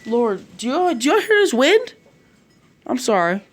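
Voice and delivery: high, loud exclamation; real startled aside. Real, not acted. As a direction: break off in astonished exasperation. Narrator breaks off over the wind.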